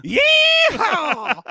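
high, loud laughing cowboy yeehaw; yeehaw shout. Acted. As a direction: whoop it like a rodeo cowboy.

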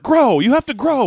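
high, insistent mock order; urgent command. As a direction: act out desperately willing a plant on. Repeats her command to the orchid.

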